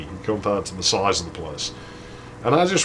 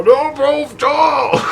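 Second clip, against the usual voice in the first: much higher, loud anguished wail; crushed friend's anguished cry; 5 dB louder, much slower than usual. Retold or imitated. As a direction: wail like your dreams just got crushed. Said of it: "you could hear his dreams been crushed".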